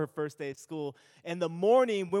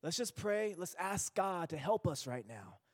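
soothing dad; 9 dB quieter than usual. Retold or imitated. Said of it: "me and Jerry tell her".

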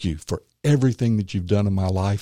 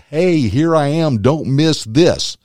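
higher, louder calling out; higher and louder, slowing on the last words; voicing the ring; 5 semitones higher, 5 dB louder than usual. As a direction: give the glowing ring a bright hey-look-here voice. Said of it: "This was glowing like".

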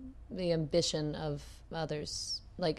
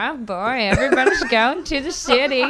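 louder, higher mock Texan twang, laughing through it; Texas accent; 6 semitones higher, 11 dB louder than usual. Texan guy redoing his accent when asked, hammed-up Texas accent. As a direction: ham up the Texan again. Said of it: "what was the one you were doing there".

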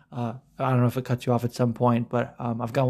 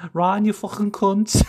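high laughing mock Scouse; Scouse Hermione; 8 semitones higher, 3 dB louder than usual. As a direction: squeal it in Scouse through laughter. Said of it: "Hermione should have a Scouse dialect".

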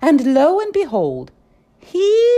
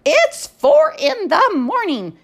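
scolding librarian; 12 semitones higher than usual. Acted. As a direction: scold the noisy books.

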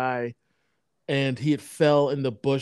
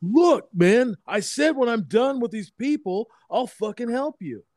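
raised, higher mock anger; retold angry line; 8 semitones higher than usual. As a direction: snap back, imitating an annoyed guy.

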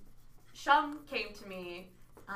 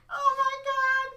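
much higher, louder gasp of shock; mock shock; 8 dB louder than usual. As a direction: squeal like the world is ending. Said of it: "And I was like".

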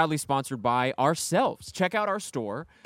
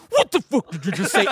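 louder, laughing mock snarl; mock coked-up anger; 3 semitones higher, 4 dB louder than usual. Singer snapping at a thirteen-year-old, mock angry. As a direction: snap at the kid like a coked-up star. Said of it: "like, on coke, goes like".

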